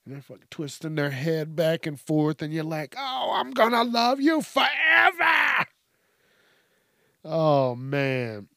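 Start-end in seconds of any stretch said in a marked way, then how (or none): 3.0-5.7 high, slow, loud adoring coo; gushing pet voice. Retold or imitated. Owner gushing at the head-tilting pet, loud and high. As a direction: overflow with love at the pet. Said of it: "in that pet voice".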